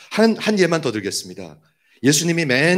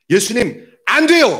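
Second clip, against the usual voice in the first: high, loud cry of protest; scolding rebuke; 10 semitones higher, 6 dB louder than usual. Retold or imitated. Peter rebuking Jesus for foretelling his death, loud scolding. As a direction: scold him, voice shooting up on 안 돼요. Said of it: "야단을 치는 거예요".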